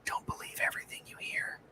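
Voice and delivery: soft unvoiced whisper; whispered aside. Executive answering a fan in a secretive whisper. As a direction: let him in on a secret under your breath. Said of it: "he goes".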